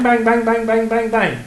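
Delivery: high, loud string of bangs; rapid-fire bangs. Acted. Teacher mocking a drill-style class, loud rapid bangs.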